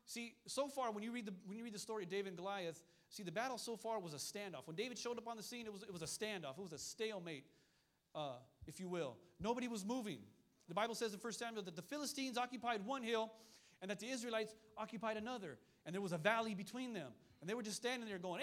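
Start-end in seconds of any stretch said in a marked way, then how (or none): none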